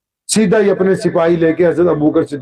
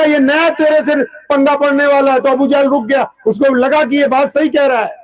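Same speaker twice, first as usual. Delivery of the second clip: higher, urgent sermon voice; preaching at full force; 6 semitones higher than usual. Acted. Adviser whispering warning to Abu Jahl, urgent preaching. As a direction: warn him with rising urgency.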